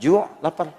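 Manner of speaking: ordinary talk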